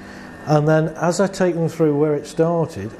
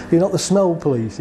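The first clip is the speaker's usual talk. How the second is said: usual speaking voice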